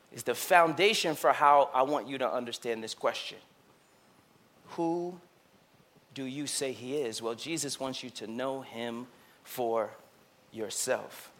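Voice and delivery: soft, intimate preaching; gentle quiet preaching; hushed sermon. Real, not acted. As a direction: drop low and quiet.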